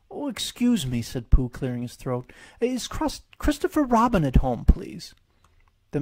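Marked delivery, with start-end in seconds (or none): 0.1-1.0 higher, politely voiced little bear; a bashful bear clearing his throat; Pooh voice. 2.6-5.1 higher, politely voiced little bear; a bashful bear clearing his throat; Pooh voice. Pooh asks at the door.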